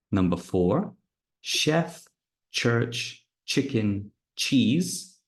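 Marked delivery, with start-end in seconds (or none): 1.4-5.2 chef with sh, church, chicken, cheese with ch; careful word list. Shown as a demonstration. Teacher reading words for students. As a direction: read each word clearly. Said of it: "Listen and circle what is different".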